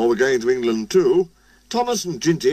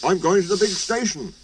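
lightly voiced, higher-pitched engine character; Thomas the engine; 6 semitones higher than usual. A narrator's reading. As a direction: lift the pitch for an eager little engine.

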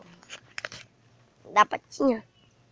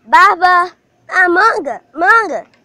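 calling out; 6 semitones higher, 10 dB louder, slower than usual. Real, not acted. Calling the dog loudly.

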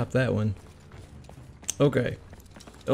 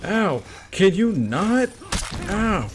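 normal talking voice

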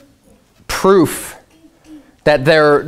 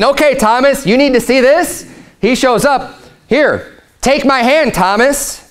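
lightly voiced Jesus, raised and eager; a hint of Jesus inviting Thomas, pitched up; Jesus speaking; 7 semitones higher than usual. Preacher voicing Jesus to doubting Thomas, gently raised.

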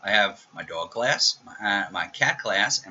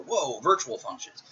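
higher, mock-overwhelmed gasp at jargon; mock amazement; 6 semitones higher than usual. Teacher imitating a student dazzled by C++ features, mock awe. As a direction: play a dazzled newbie, then trail off into blah blah. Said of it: "look into C plus plus and go".

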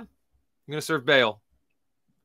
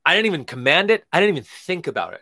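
emphatic God; 4 semitones higher, 7 dB louder than usual. Acted.

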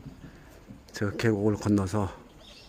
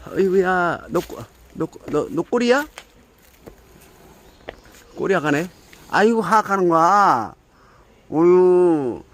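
surprised; 6 semitones higher, 5 dB louder than usual. Real, not acted. Startled by hissing cat.

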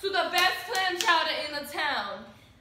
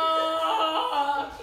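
slow drawn-out laughing name; laughing Deborah!; laughing callout; much slower than usual. Real, not acted. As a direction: call her name through laughter. Calling her out laughing.

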